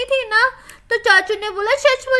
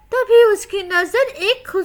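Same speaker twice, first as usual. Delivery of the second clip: ordinary delivery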